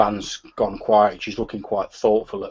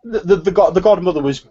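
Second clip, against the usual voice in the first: stumbling, insistent protest, raised voice; agitated gang member; 4 semitones higher, 6 dB louder than usual. Acted. Gang member protests to a rival that nobody would do this, agitated. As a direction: argue it hotly, tripping over the words. Game master voices indignant character.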